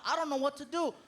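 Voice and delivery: ordinary delivery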